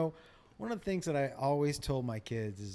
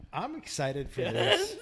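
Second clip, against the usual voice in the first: mock excitement; 9 semitones higher, much slower than usual. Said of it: "And I was like".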